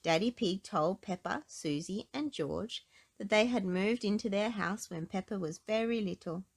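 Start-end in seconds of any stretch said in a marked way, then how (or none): none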